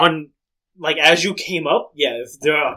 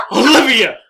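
higher, louder mock indignation; hurt, indignant host; 6 semitones higher, 6 dB louder, slower than usual. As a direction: protest loudly like a betrayed friend. Host answers insult in quarrel.

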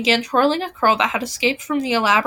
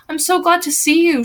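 higher, moderately excited exclamation; glad shout; 6 semitones higher than usual. A narrator's reading. Blythe hugging Mimi, mildly shouted joy. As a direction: exclaim it with delighted relief. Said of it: "she shouted".

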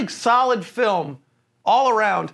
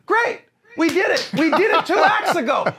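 high-pitched sarcastic exasperation; mock celebration; 6 semitones higher than usual. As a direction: celebrate it mockingly, voice raised in pitch. Mocking the film's repeated compromise.

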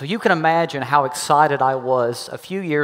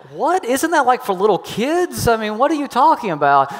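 incredulous; 6 semitones higher than usual. Retold or imitated. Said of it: "And I thought".

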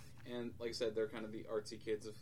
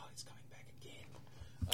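put-on hushed old-timer's warning; very soft, foreboding quote; ominous whisper; 8 dB quieter than usual. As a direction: whisper a spooky premonition. Old woman warns of the Chupacabra.

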